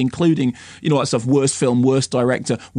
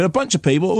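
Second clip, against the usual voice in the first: usual speaking voice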